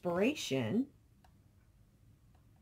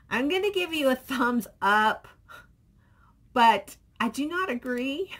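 usual speaking voice

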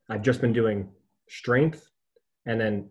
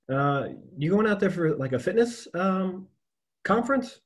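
curious stranger voice; 8 semitones higher than usual. Retold or imitated. Stranger in line asks him.

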